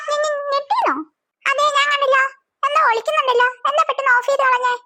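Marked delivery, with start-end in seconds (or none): 0.1-0.9 high, flustered stutter; startled stammer. Acted. Elder sister denying to younger that she flinched, flustered stammer. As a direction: trip over the first syllable, caught out. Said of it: "ചേച്ചി എന്തിനാ ഞെട്ടിയേ?".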